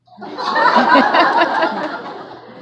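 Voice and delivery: high, loud burst of laughter. Real, not acted. Honoree cracks up laughing at her old goal.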